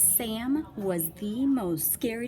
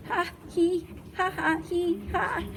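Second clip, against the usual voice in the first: delighted laugh; 10 semitones higher than usual. Acted. Monsters laughing.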